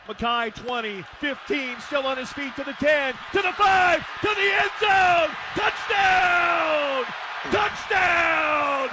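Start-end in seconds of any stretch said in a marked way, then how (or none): 3.3-8.9 high, drawn-out shouts; exultant touchdown call. Real, not acted. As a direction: ring out the final score in triumph.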